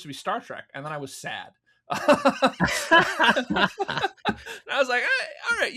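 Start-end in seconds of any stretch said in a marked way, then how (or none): 1.9-3.0 high bright laugh bursting out; real laugh. Real, not acted. Laughing at own sad joke. 3.1-5.7 high, laughing words; half-laughing grudging reply; laughing through words. Real, not acted. Laughing while quoting self.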